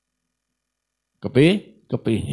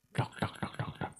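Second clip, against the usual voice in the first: soft whispered knock knock knock; imitated knocking; 17 dB quieter than usual. Preacher imitating the kiai knocking on the guest's door, whispered knocks. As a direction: tap out a door knock under the breath.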